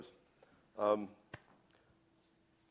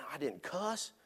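higher, baffled self-defense; baffled inner protest; 6 semitones higher than usual. Retold or imitated. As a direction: puzzle it out, incredulous. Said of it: "I'm going through my mind".

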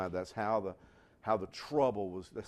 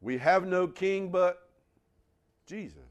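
loud, firm creed of the early Christians; defiant declaration; 6 semitones higher, 6 dB louder than usual. Retold or imitated. Preacher voicing the Christians' defiance of Rome, loud and firm. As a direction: proclaim it boldly as a martyr would.

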